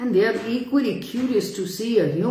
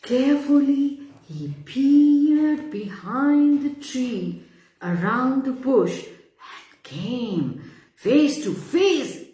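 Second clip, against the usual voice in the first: building suspense; 6 semitones higher, 3 dB louder than usual. A narrator's reading.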